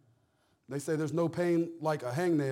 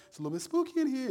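a light Donkey-from-Shrek voice, higher and quicker; Shrek's donkey; 8 semitones higher, faster than usual. Retold or imitated. Pastor lightly imitating Donkey for the congregation, higher, quicker. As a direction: lightly squeak it out like the nervous donkey. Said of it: "I'm kinda like the donkey from Shrek".

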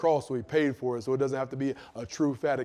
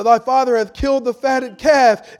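slow, loud called-out announcement; servant's announcement; 9 semitones higher, 13 dB louder than usual. Acted. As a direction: call it out like a servant bringing news. Servant announces feast, shouted.

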